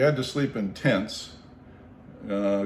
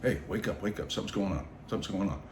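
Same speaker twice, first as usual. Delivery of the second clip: softer urgent inner voice; little inner voice; 7 dB quieter, faster than usual. Retold or imitated. Inner voice waking him at night, soft urgent. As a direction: nudge yourself awake under your breath. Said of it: "this little voice in my head going".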